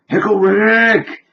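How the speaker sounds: high, slow, stretched-out cartoon cry; Pickle Rick shout. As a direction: belt out the catchphrase like a cartoon hero. Retold catchphrase shout.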